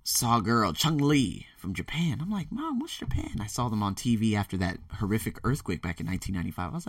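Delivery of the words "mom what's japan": put-on small, high, soft child voice; curious child. Boy asking mom about Japan, imitated child voice. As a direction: ask it high and quiet, like a little kid.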